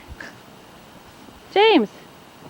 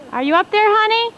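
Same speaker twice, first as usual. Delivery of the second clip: calling up to window; 9 semitones higher, 3 dB louder than usual. Real, not acted. Calling to husband on roof.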